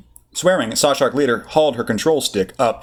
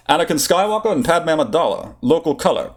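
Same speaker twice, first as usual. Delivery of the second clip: put-on Core Worlds accent; 7 semitones higher than usual. Obi-Wan replies.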